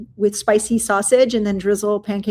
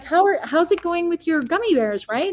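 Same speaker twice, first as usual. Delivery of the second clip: higher, chirpy small-talk voice; lightly voiced polite acquaintance asking; imitating old acquaintance; 7 semitones higher than usual. Acquaintance at a party asking about gummy bears, high and chirpy. Woman quoted at fancy party.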